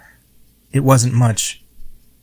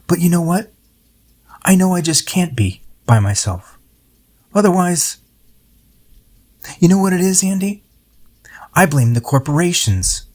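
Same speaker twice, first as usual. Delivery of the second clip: lightly voiced older friend, higher and musing; a hint of Charles, higher and rambling; voicing Charles; 7 semitones higher than usual.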